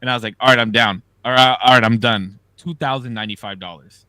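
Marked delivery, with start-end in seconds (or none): 0.4-2.4 loud giddy cashing out; calling it quits while ahead; elated quitting. Retold or imitated. To dealer after a winning streak, loud and elated.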